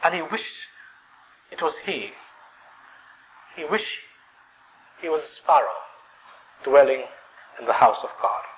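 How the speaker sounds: soft, halting, with long pauses; hushed wistfulness. Real, not acted. Preacher tells of wishing to be a sparrow, hushed. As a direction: let the wish hang in the air.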